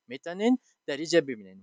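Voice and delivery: usual speaking voice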